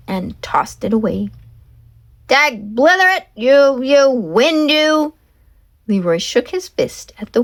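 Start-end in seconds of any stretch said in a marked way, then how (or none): 2.3-5.1 high, cranky cursing; mock anger. Leroy cursing the wind for his hat, hammed-up anger. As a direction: shake your fist at the wind.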